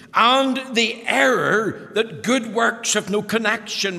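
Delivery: fervent preaching. Acted. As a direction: preach it out to the congregation. Preaching at full force.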